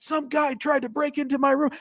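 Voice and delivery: high-pitched, indignant mock complaint; retold pissed-off woman. Woman reports a break-in to front desk, mock outraged. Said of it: "really pissed off".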